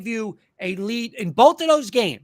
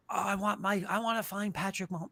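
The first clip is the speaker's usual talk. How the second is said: softer, whiny fan voice; mock the dreamy fan, much softer; 9 dB quieter, faster than usual. Host mimicking fans who want the next Mahomes, softer mock whine. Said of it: "people are just".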